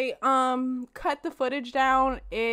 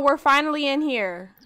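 ordinary talk, nothing marked

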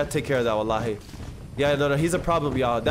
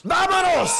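high shouted call to ride out; rallying shout; 11 semitones higher, 4 dB louder, slower than usual. Acted. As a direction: shout it with the gang. Joining the gang's rallying cry.

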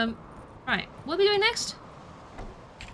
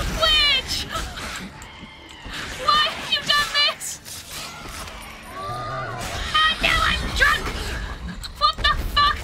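panicked yell; 9 semitones higher than usual. Real, not acted. Streamer shouting at the pet while drunk in game, high panicked yelling. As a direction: yell in real panic as chaos hits.

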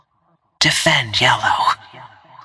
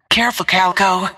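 quick, high warning call; higher, hurried caution; urgent warning; 6 semitones higher, faster than usual. Acted. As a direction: warn a teammate fast. Game warning to a teammate.

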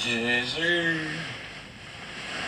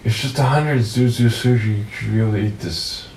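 louder insistent sleep talk; 2 semitones higher, 7 dB louder than usual. Real, not acted. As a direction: press the point while asleep. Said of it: "I literally took the sound from Joker".

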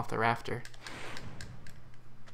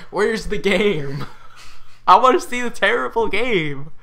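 mock whiny gamer; 7 semitones higher, 6 dB louder than usual. Player mocking the dull game, whiny. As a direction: whine like a cheated player.

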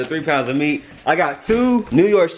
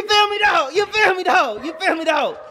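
high teasing voice; 12 semitones higher than usual. Pretend.

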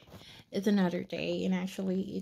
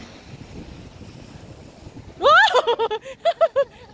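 high, loud burst of laughter; 9 dB louder than usual. Real, not acted. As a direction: crack up loudly.

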